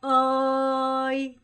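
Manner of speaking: 꼬어이 stretched slowly, level and loud; slow level call. Shown as a demonstration. Teacher models calling her, slow level call. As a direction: call 꼬어이 long and flat. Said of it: "저를 부를 때 어떻게 했어요".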